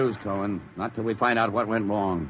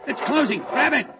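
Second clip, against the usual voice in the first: much higher, urgent orders; hurry, it's shutting on them; urgent alarm; 13 semitones higher than usual. Acted. Hatch sliding shut.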